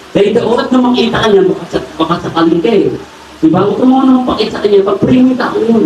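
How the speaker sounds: high, insistent preacher's exhortation; fervent preaching. Acted. Preaching at full force.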